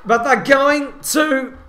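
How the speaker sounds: loud, high rugby commentary at full pitch; excited play-by-play. Real, not acted. Commentator calling a possible try as it goes to video, excited play-by-play. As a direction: call the big moment over the crowd, stretching key words.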